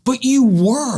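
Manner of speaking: incredulous protest. Real, not acted. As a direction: protest that he really was dead to him. Telling the friend he had died.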